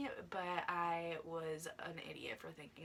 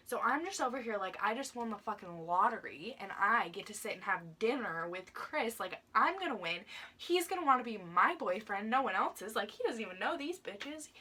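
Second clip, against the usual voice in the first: loud, gushing, climbing higher to a squeal; giddy excitement; 4 semitones higher, 7 dB louder than usual. Retold or imitated. Girl imagining dinner with her crush, loud and giddy. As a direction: gloat like you just won the lottery. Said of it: "I was just freaking out".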